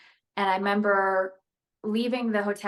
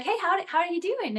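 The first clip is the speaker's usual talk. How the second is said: much higher, bubbly Southern Belle greeting; Belinda Hale; 9 semitones higher than usual. Retold or imitated. Greeting the Uber driver as a bubbly Southern Belle. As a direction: chirp it like a lively Southern Belle. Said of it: "talk like this and be like".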